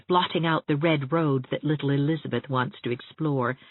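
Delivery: ordinary delivery, nothing marked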